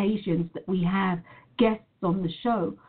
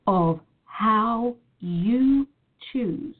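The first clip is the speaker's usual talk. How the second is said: slow emphatic delivery; slower than usual. Real, not acted. Emphatic slow motivational point.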